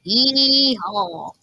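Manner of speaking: loud Texan cowboy holler; cowboy yeehaw. Pretend. Player playing Texan, hollering a cowboy yeehaw. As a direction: whoop it like a rodeo cowboy. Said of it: "Bro thinks he's Texan".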